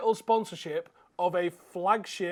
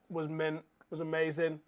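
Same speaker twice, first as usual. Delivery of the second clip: ordinary talk, nothing marked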